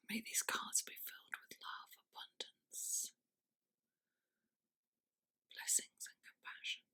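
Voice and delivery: very soft, voiceless whisper; whispered blessing. Real, not acted. Tarot reader blessing the cards, whispered. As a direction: whisper a private prayer over the deck.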